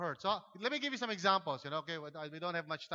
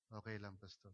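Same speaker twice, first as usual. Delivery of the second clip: much lower, much softer mumble; withdrawn mumble; 11 semitones lower, 14 dB quieter than usual. Retold or imitated. Silent churchgoer answering the pastor, low and barely audible.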